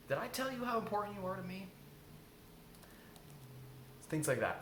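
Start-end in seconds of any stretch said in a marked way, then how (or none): 0.1-1.7 tender high. Shown as a demonstration. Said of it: "now we're just giving love".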